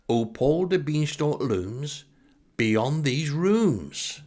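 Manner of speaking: rhyming recital. Retold or imitated. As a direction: recite it like a grand poem. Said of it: "Clive joins me with his rhymes".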